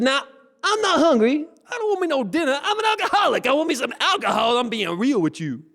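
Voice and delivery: very high, protesting character voice; insistent alcoholic. Acted. Alcoholic man refuses the dinner offer, high-pitched insistence. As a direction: protest up high, easing down toward the end.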